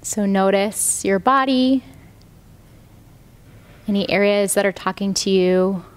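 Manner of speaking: calm, even meditation voice with long pauses; guided meditation. Real, not acted. Yoga teacher guiding students lying down into rest, meditative.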